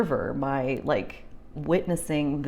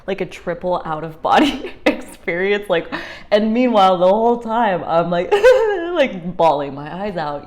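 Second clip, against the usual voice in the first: loud, high laughing burst; real laughter spilling out; 4 semitones higher, 11 dB louder than usual. Real, not acted. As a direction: laugh through the telling. Laughing while telling of bawling.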